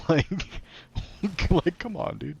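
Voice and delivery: high laughing protest. Real, not acted. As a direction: laugh through the complaint. Laughing at zombie ending.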